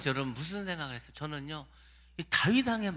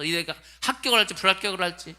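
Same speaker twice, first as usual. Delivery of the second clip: usual speaking voice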